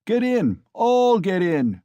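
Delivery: loud, high and drawn out; drunken cry. A narrator's reading. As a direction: bellow the invitation. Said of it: "cried Mikolka".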